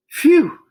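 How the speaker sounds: loud high exhale; big relieved phew. A narrator's reading. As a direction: blow out relief after hard work. Relief after long calculation.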